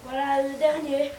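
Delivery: ordinary delivery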